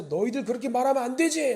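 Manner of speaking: high-pitched mock scolding; angry rebuke. God answers the complainers, in an acted rebuke. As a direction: tell them off, pitch jumping up. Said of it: "하나님께서 화를 내서".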